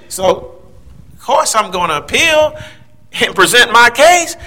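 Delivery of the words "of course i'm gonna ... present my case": ordinary talk, nothing marked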